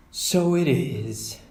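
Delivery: lightly voiced mother, slow and amused; Mama. Mother answering her cub's monster joke, amused and slow. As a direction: play along with the joke, slowly.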